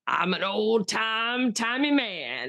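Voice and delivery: put-on a light, slow old-timey singer voice; lightly imitating a slow old-time crooner. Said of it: "And he's got a voice like this".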